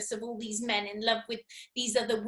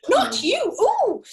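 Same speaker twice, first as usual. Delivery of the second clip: mock horrified cry; 12 semitones higher, 9 dB louder, slower than usual. Imitating men's horrified reaction.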